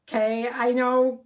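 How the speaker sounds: ordinary talk, nothing marked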